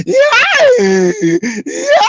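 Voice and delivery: very high, loud wailing yeah; mock crying yell. Mocking the boxer crying after winning, a loud wail. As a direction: shriek it like a sobbing winner. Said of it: "He's like".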